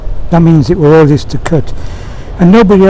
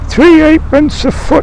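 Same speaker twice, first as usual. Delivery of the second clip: pitched up high, stubborn; stubborn high insistence; 11 semitones higher than usual. Acted. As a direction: squeak it out, won't budge. Sam insists, chin in water.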